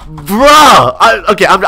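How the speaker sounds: loud, high, long cry of disbelief; indignant outburst; exasperated yell. Real, not acted. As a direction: blurt it out high, then stammer. Outraged at being compared unfavorably.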